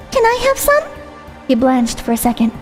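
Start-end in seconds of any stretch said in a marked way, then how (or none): 0.1-0.8 put-on high sweet girlish voice; bright, breathy young girl; high cute anime girl. Voiced female character.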